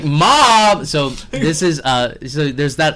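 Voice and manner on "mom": mock dramatic cry.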